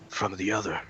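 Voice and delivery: hushed Fey Lord, halting between phrases. Acted. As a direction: answer softly, leaving long pauses. Game master voices Fey Lord.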